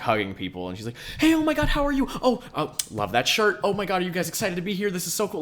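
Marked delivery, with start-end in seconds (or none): 1.2-5.4 gushing, high-pitched, loud greeting; hyper mom. Retold or imitated. Camp mom greeting and hugging everybody at pickup, hammed-up hyper excitement. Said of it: "just super ridiculously hyper".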